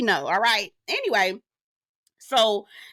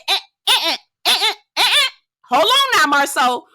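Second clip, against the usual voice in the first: high, loud "eh, eh" to cut him off; loud interrupting call; 8 semitones higher, 5 dB louder than usual. Retold or imitated. Talking back at Marceau on TV, loud and high. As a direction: shout him down mid-sentence.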